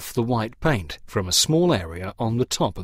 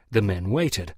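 plain reading voice

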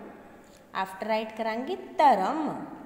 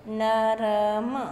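sounding out slowly; 7 dB louder, much slower than usual. Shown as a demonstration. Teacher stretching ਨਰਮ for young pupils, slow and loud. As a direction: stretch ਨਰਮ out slowly and loudly so every letter is heard. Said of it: "ਤਿੰਨੋਂ ਤਿੰਨੋਂ sounds ਆ ਰਹੀਆਂ ਨੇ".

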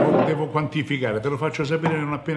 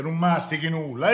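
normal talking voice

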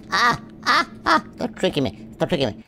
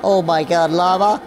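ordinary talk, nothing marked

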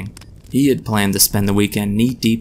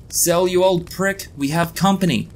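yelled; 9 semitones higher than usual. A narrator's reading. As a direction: yell a warning to the back room. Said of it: "he yelled".

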